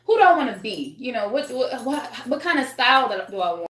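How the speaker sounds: ordinary delivery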